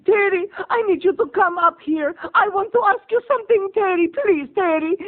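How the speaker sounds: hammed-up calling mom voice, high and loud; mother calling out. Neighbor mom speed walking, calling Terry up, high and loud. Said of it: "she goes".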